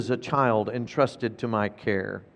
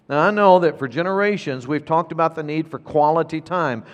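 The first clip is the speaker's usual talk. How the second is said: usual speaking voice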